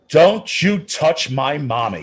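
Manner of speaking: put-on high, slow little-boy voice defending his mom; a small child standing up fierce and slow; little boy's protest. Little son warning the man who shoved his mother, high and slow.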